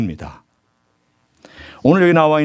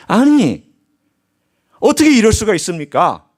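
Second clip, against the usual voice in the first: angry protest to father; 7 semitones higher than usual. Retold or imitated. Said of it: "아버지에게 막 화를 내고 분노를".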